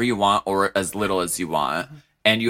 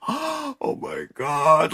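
mock shock; 6 semitones higher, much slower than usual. Recalls gasping at walk-off.

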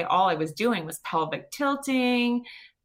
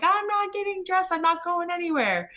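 mock yell; 11 semitones higher than usual. Refusing to get dressed for the hospital, high defiant mock yell. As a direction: refuse hard, voice jumping way up. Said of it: "I yelled at him too".

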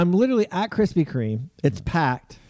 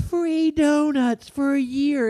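cheerful cashier; 12 semitones higher, slower than usual. Retold or imitated. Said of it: "she goes".

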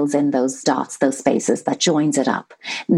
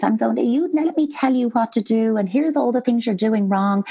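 higher-pitched nagging parent voice; 6 semitones higher than usual. Pretend. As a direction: nag like a parent laying down the law. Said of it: "that nagging parent voice".

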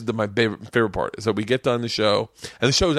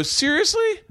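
very high, squeaky disbelief; high-pitched incredulous question; slower than usual. Retold or imitated. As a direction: shoot way up high as if pleading with the crowd. Acts his disbelief at silent audience.

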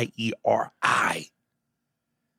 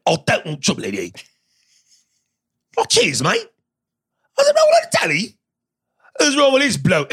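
hammed-up British bloke voice, much higher; mock British accent; 12 semitones higher, 5 dB louder than usual. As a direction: camp it up as a cheeky British bloke. Mocking English dudes after complaint.

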